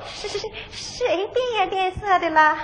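slow, soft, frightened denial with a stutter; 是，是 stumbled, then 变颜变色 dropped low and slow; nervous stammer. Acted. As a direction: stammer it out, caught and scared. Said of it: "你看你脚打颤，手哆嗦，说话断条".

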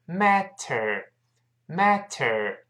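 "matter" very slowly with a full t; slow, careful "matter" with the t released. Shown as a demonstration. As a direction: sound out "mat-ter" in slow motion. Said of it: "读非常慢是matter".

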